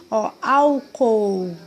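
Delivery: "álcool" said very slowly, both o's drawn out; slow down "álcool" so the extra o is heard; stretched syllables. Shown as a demonstration. Teacher showing children the double o in "álcool", very slow. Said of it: "a gente sente que a gente tá falando um ozinho a mais".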